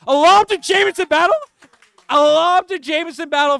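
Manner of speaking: high, loud cry of amazement; excited shouted call. Real, not acted. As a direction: shout the big play in disbelief. Calling a surprise lob play.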